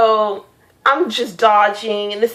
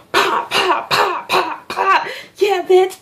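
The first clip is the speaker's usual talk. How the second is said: high playful pew-pew gun noises; finger-gun shots; 11 semitones higher, slower than usual. Retold or imitated. Shooting finger guns at the dream monster, high playful gun noises. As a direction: fire finger guns, cocky.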